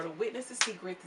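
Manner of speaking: ordinary talk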